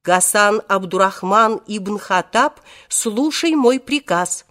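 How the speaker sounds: ordinary delivery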